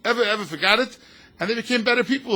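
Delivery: rising sermon emphasis; emphatic preaching. Real, not acted. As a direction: drive the point home.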